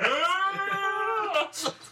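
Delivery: high burst of laughter. Real, not acted. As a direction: crack up hard. Laughing at alien shriek bit.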